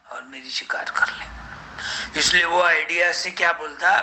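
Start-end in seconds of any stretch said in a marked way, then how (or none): 0.1-1.2 whisper. Acted. Jackal fears the lion inside.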